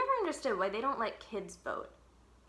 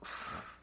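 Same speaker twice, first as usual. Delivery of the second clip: sigh; 8 dB quieter than usual. Acted. Narrator sighs before answer.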